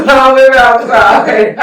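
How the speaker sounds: slow, sing-song echo while laughing. Real, not acted. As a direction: repeat it through laughter, stretched out. Laughing echo of the retold line.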